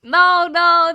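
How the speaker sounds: loud laughing no; loud protest. Real, not acted. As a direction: shout it out laughing.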